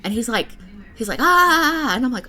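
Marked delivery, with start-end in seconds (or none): 1.2-2.0 high, loud mock yell; imitated yell. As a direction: imitate a man crying out in pain. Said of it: "I heard this yelling".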